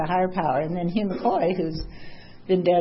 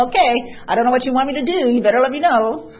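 demanding; 5 semitones higher, 7 dB louder than usual. Retold or imitated. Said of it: "talking like that".

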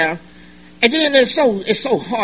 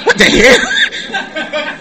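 drawn-out what the hell; 11 semitones higher, much slower than usual. Real, not acted. Reacting to cotton mouth.

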